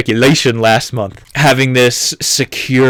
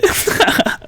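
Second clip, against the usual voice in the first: airy laugh; 3 dB quieter than usual. Real, not acted. Laugh after joke.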